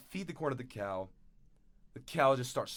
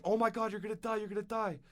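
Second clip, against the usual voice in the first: panicked; 9 semitones higher, faster than usual. Retold or imitated. Characters panic over dying man.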